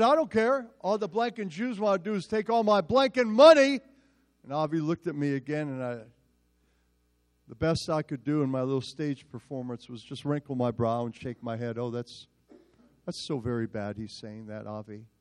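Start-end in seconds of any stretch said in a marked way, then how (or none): 0.0-3.8 higher and louder, sneering rant; loud retold rant. College boy keeps shouting slurs. 11.8-15.1 soft, lower, sheepish murmur; timid mock murmur. Pastor's feeble pretend reaction.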